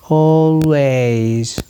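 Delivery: ALWAYS with the first vowel held very long; long vowel sound. Shown as a demonstration. Teacher showing a long sound, drawn-out ALWAYS. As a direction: stretch the start of ALWAYS slowly. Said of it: "compare these short and long sound".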